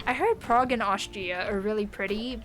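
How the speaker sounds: ordinary delivery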